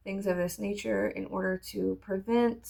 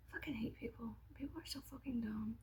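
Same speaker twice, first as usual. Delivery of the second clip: hushed, exasperated whisper under the breath; whispered aside; 2 semitones higher, 18 dB quieter than usual. Real, not acted. Aside to viewers, whispering her disgust at people.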